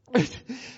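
high nervous little laugh; embarrassed giggle. Acted. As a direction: laugh off the slip.

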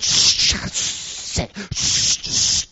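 repeated sharp shushes; hushing shush. Pretend. Deacon shushing a noisy worshipper in church, urgent hush. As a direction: shush someone firmly, over and over. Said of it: "deacon or usher come over and".

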